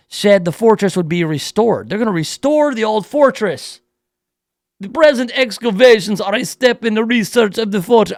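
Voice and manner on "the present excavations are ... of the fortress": a hint of a mock Jamaican accent reading the quote. Host reading the archaeologist's quote, slipping into Jamaican accent. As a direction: read the archaeologist's words in a light Jamaican lilt. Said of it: "I just turned Jamaican".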